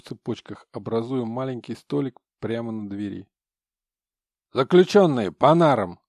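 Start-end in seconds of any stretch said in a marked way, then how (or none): 4.5-6.0 loud prison guard order; barked command. Acted. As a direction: shout the order down the cell. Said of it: "Раздалась обычная команда".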